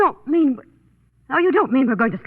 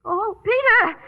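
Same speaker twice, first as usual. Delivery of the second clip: high, tearful calls into the night; panicked yelling; 13 semitones higher, slower than usual. Acted. Woman alone at night calling for her missing companion, panicked yelling. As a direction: cry out for someone who has vanished, panic mounting. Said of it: "what are you yelling about".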